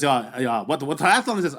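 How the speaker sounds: lightly put-on German accent; casual small talk with a hint of German; German athlete. German athlete chatting before the start, imitated accent. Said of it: "German athlete".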